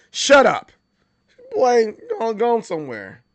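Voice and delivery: loud, high, exasperated cutoff; acted burst of fed-up dismissal; mock parental outburst. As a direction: play an exasperated parent snapping. Acted parent cuts off the kid.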